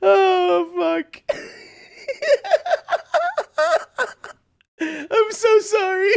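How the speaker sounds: very high, drawn-out words through laughter; squeaky real cracking-up, slow and breaking; helpless laughing apology. Real, not acted. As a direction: laugh until nearly crying while saying sorry.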